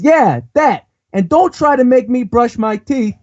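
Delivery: put-on high, slow, cocky punk kid; delinquent kid voice. Reading a manga kid's line to friends, high cocky punk voice. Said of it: "He says".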